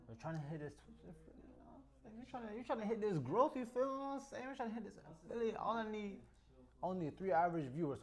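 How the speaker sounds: high excited pleading to chat. Real, not acted. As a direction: hype up the viewers. Hyped appeal to chat, high.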